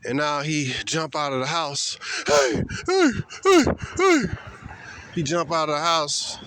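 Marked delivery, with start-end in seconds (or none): 2.3-4.2 high, loud mock calling out; mock shout. Voicing the boyfriend rushing police, loud high hey. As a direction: call out like a man charging in.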